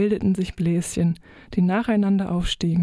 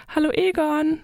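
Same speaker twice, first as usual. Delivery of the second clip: hoch und fröhlich gerufen, wie viele kleine Fische; fröhlicher Gruß; 9 semitones higher than usual. A narrator's reading. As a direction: freudig grüßen, hell und hoch. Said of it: "schallte es mir fröhlich". (fröhlich und hoch gerufen)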